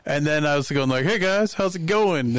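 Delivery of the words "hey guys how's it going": high, drawn-out mock-casual hello; awkward greeting. Uninvited guest greeting wrestlers backstage, awkward. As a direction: put on an overly breezy, high-pitched hello.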